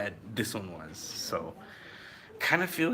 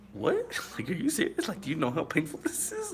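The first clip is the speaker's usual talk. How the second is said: shocked disbelief; 9 semitones higher than usual. Retold or imitated. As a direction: jump up high in sheer disbelief. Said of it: "the dude was like".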